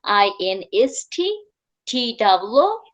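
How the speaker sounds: ordinary talk, nothing marked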